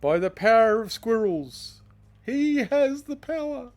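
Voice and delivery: slow, booming high villain proclamation; dark lord. Acted. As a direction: boom it out like a mighty ruler. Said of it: "that's King Squirrel".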